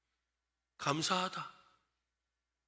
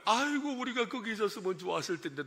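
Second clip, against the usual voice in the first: softer mock bemoaning, trailing downward; acted lament; 5 dB quieter than usual. Israelites wishing they were back in Egypt, mock lament. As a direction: sigh it out as a put-on woeful grumble. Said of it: "넋두리 하는 거".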